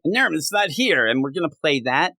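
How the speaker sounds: high sneering villain voice; hammed-up Goblin villain taunt; Goblin's taunt. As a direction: sneer it like the Goblin, pitched well up. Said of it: "Norman turns Goblin".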